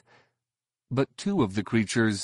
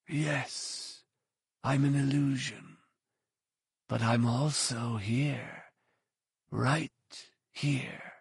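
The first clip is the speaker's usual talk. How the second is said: soft, slow sinister whisper; 3 semitones higher, 8 dB quieter, slower than usual. A narrator's reading. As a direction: hush it like a menacing shadow. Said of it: "The hooded man's voice came back as a whisper".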